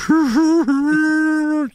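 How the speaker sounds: high, loud put-on laugh; mom laughing hard. Mom laughing at her son on the toilet, high cackle. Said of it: "she's laughing at me".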